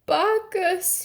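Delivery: high desperate call to the heavens; anguished cry. A narrator's reading. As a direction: cry out in despair. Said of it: "he cried".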